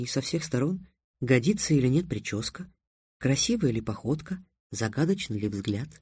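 put-on soft girl's voice with lively questioning lifts; lightly voiced Тиана, incredulous. Тиана explains to Трикс why a double beats mirrors, soft and eager. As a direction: explain it quietly, amazed he doesn't get it.

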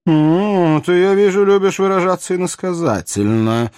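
high-pitched royal annoyance; needled monarch, poorly suppressed vexation; vexed king. A narrator's reading. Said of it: "не в силах подавить свою досаду".

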